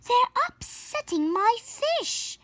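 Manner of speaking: high, slow fussy complaint; complaining. Acted. Neighbor grumbling about the Louds, high and whiny. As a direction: whine about the fish in a high voice.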